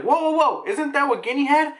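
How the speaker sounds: high, alarmed group of guys; high-pitched "whoa" of disbelief; startled reaction. Retold or imitated. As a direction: exclaim it in mock alarm. Retold dudes react in alarm.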